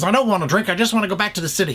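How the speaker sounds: high, fast, pleading protest; protesting Cary Grant. Retold or imitated. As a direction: rattle it off high like a cornered man. Drunk Cary Grant refusing drink.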